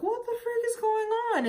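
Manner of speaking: sudden high-pitched exasperated question; baffled outburst. Retold or imitated. Retelling her confusion at the spotting, a high, baffled outburst. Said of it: "And I was like".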